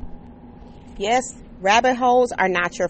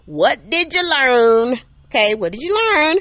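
mock scolding; 5 semitones higher, 5 dB louder than usual.

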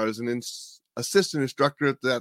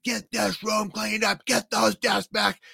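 angry yelling; 9 semitones higher than usual. Retold or imitated. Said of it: "he started screaming and yelling".

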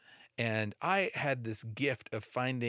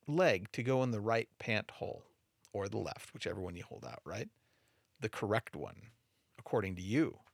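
normal talking voice

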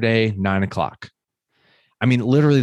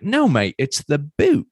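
English friend; 8 semitones higher, slower than usual. Retold or imitated. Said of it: "He's like".

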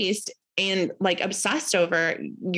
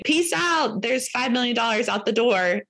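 higher, breezy peace-out; a higher, careless goodbye to money; mock carefree; 4 semitones higher than usual. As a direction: toss the money away with glee. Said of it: "other times you're like".

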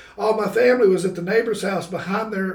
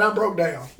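ordinary delivery

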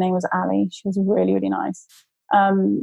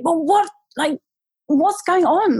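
anxious question; 9 semitones higher than usual. Retold or imitated. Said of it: "So I was just like".

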